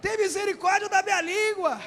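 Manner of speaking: loud, fast call to pray together; fervent group prayer. Shown as a demonstration. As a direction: lead the church in a rising plea. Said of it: "Levanta sua mão e fala".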